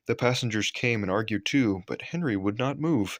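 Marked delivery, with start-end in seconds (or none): none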